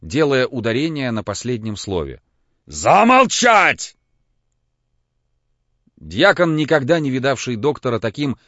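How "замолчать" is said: очень медленно, громко, высоко; рявкает, растягивая слово; грозный окрик. A narrator's reading. Самойленко кричит в гневе. (грозный окрик)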